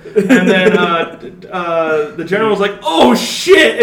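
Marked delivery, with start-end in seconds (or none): 2.8-3.8 high, loud panicked yelp of "oh shit"; panicked shout. Retold or imitated. General fleeing Razzie, loud panicked shout.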